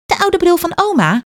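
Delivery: put-on high, bright child voice; little girl. A narrator's reading. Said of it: "zegt Kaat".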